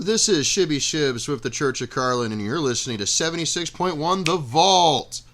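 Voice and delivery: ordinary delivery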